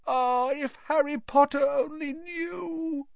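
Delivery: high, tearful elf wail; weepy high-pitched lament; groaning house-elf. A narrator's reading. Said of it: "Dobby groaned".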